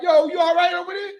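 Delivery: high, shouted call across the block; young guys shouting to check on a woman. Retold or imitated. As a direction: yell it over to check on her. Block kids calling out.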